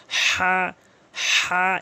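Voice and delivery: হা breathed firmly from mid throat. Shown as a demonstration. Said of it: "এভাবে". (হলকের মাঝখান থেকে হা)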